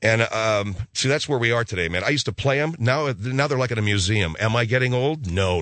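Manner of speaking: usual speaking voice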